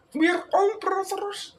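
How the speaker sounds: very high, slow mock Indian accent. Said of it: "they got the Indian".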